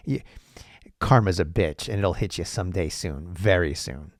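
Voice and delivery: ordinary talk, nothing marked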